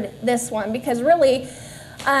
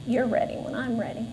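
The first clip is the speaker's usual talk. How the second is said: much softer, gentle inner voice; small inner voice; 3 semitones lower, 9 dB quieter than usual. Retold or imitated. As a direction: let it come from deep inside, barely above a breath. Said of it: "a small voice inside of me".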